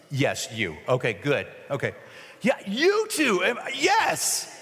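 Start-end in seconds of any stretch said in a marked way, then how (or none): 2.4-4.5 high, drawn-out call to the crowd; high-pitched, emphatic hailing; exasperated call-out. Real, not acted. Calling chosen volunteers out of crowd.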